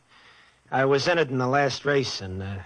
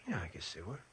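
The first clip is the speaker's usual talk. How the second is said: hushed, much softer murmur; soft whisper; 7 semitones lower, 16 dB quieter, faster than usual. Acted. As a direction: breathe it while gazing at her. He is gazing at the girl.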